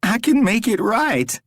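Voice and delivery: high, desperate begging; pleading captive Rory. A narrator's reading. Rory begs the stranger to spare him, desperate.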